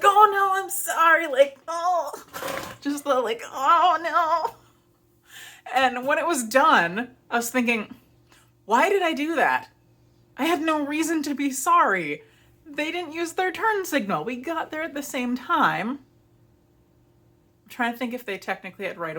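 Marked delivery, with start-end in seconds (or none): 0.0-4.5 high, loud, flustered "oh no, I'm sorry"; hammed-up panic, much higher and louder; panicked apology. Reenacts panicked apology to driver. 8.7-16.0 exasperated self-questioning. Retold or imitated. Retells her own exasperated thought.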